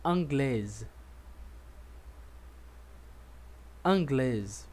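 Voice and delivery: anglaise with the final z sounded; model for repeating. Shown as a demonstration. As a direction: anglaise said twice for the class to repeat. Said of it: "Vamos repetir comigo".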